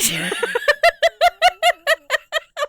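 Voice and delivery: shrieking laughter; real laughing fit; high helpless laugh. Real, not acted. Helpless high laughter at her own dad story.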